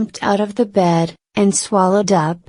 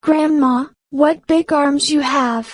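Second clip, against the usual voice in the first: put-on higher, wondering little girl; a small girl puzzled at grandma; young girl; 7 semitones higher than usual. Girl questioning the disguised wolf in a higher voice.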